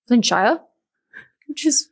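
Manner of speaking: amateur English accent on Flintshire; attempted English accent. Shown as a demonstration. Podcast host retries an English town name, attempted English accent. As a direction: have a shaky go at an English accent. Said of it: "with an English accent".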